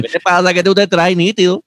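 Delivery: put-on high, loud boricua accent; Puerto Rican accent. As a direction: play a brash Puerto Rican local. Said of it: "los boricuas".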